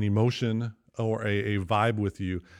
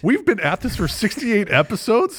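surprised; 10 semitones higher, 8 dB louder, slower than usual. Real, not acted. Disbelief at episode count.

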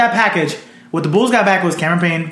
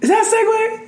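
high disbelieving question; mock surprised. Real, not acted. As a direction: act baffled by the segue. Questioning the awkward topic change.

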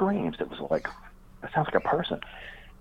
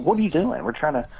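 a retold startled cry, pitched higher; hammed-up alarm at the screaming woman; alarmed question; 10 semitones higher than usual. Man asks the screaming woman what's wrong, mock alarm. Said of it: "I was like".

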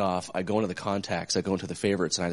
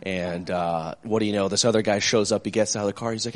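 usual speaking voice

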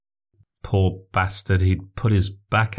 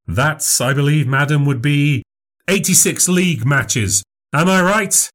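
higher, bright showman voice performing for a crowd; showman memory act; 6 semitones higher than usual. Acted. Memorizer answers Chelsea question.

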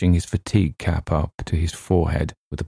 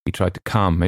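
ordinary delivery, nothing marked

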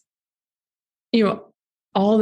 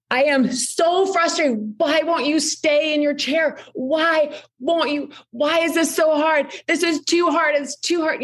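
hammed-up anger work, voice pitched well up; venting anger; 9 semitones higher than usual. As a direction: let the frustration pour out, half playing it. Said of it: "I let it all out from the place of anger".

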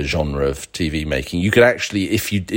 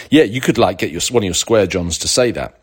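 ordinary talk, nothing marked

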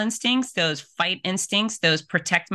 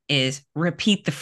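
usual speaking voice

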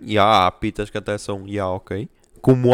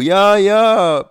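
patronizing agreement; 7 semitones higher, 6 dB louder than usual. Retold or imitated. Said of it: "E eu".

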